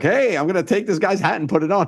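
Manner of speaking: high, drawn-up "hey" to a stranger; retold prankster's call. Acts out approaching a stranger for prank.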